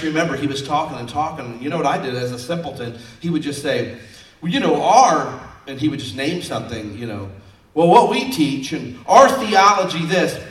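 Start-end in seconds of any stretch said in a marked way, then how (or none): 4.4-5.4 loud, pitched-up mimicry of a know-it-all; mocking imitation of a boastful preacher; show-off pastor. 7.7-10.4 loud, slow, raised-pitch know-it-all; mock self-important theologian; show-off pastor.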